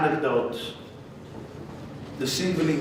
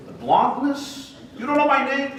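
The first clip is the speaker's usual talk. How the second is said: boastful Trump; 10 semitones higher than usual. Acted. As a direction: savour each of your own charms. Trump boasts about himself.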